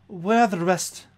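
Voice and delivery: high, loud, stammered plea; alarmed questioning. Acted. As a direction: demand urgently where everyone is. Shaken lady asks after her people.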